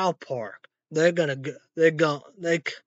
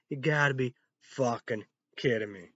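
ordinary delivery

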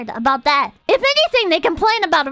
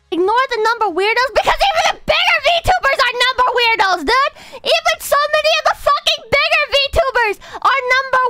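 raised, heated ranting; 8 semitones higher than usual. Real, not acted. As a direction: really let loose the rant. Ranting about number weirdos.

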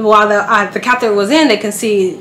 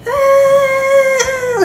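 baby cry imitation.

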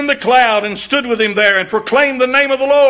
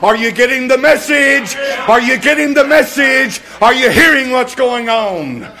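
louder, higher repeated challenge; preaching at full force; 4 semitones higher, 3 dB louder than usual. Acted. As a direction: drive the question at the crowd. Preacher repeats question to crowd.

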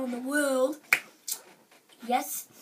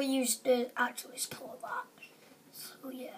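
whisper; 10 dB quieter than usual. Real, not acted. Whispered aside.

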